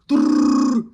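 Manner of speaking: loud rolled-tongue drum noise; drum-roll heartbeat. Retold or imitated. Scared kid's heart doing drums, loud imitated roll. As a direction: trill a racing drum roll. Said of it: "like, doing some drums".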